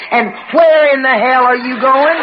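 high, indignant retold challenge; angry demand. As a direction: call after him, outraged. Chasing husband out, acted quarrel.

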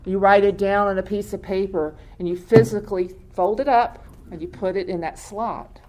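ordinary delivery

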